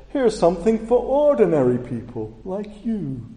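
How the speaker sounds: high, loud, talking down to the audience; condescending Max Headroom. Retold or imitated. Preacher mocking a condescending TV host, high and loud. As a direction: sneer it out like a smug TV host. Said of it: "in a very condescending way".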